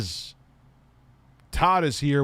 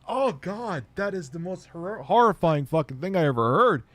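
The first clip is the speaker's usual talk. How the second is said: mock horror; 7 semitones higher than usual. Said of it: "I was like".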